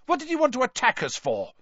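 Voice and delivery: high, fast protest; indignant. Acted. Ron to the wizards who attacked, indignant. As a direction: complain in a quick, indignant rush. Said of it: "looking indignantly at his father".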